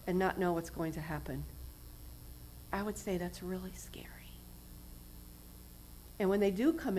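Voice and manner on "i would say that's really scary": hushed and intimate; whispered. Real, not acted. Preacher confiding to the church, whispered. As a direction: lean in and whisper it. Describing fear of recovery house.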